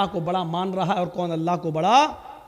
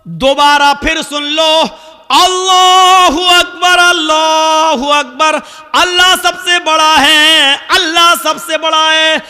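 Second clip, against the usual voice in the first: loud, raised, ringing proclamation with held endings; full-force preaching; 9 semitones higher, 15 dB louder than usual. Acted. Preacher summons shopkeepers, farmers and office workers to prayer, full-force proclamation. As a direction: call out like a crier summoning a crowd. Preacher calls out to busy people.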